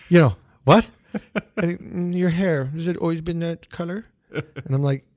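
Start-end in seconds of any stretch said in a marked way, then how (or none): 0.1-0.9 loud, rising puzzled reply; acted bewilderment, punched up; baffled. Retold puzzled answer to boss. 1.8-4.0 higher, nonchalant boss impression; Doctor Evil-like imitation, pitched up; Lorne Michaels. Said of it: "he talks like this".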